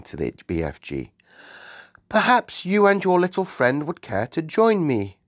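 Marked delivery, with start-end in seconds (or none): 2.1-5.2 high-pitched regal invitation; gracious Queen. Acted. Queen invites guests to breakfast, gracious. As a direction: invite them politely in a raised royal voice.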